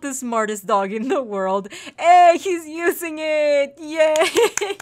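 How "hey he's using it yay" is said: high, loud, drawn-out whoop; thrilled squeal of success; delighted cheer. Real, not acted. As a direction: cheer the pet on through laughter. Delighted the dog uses it.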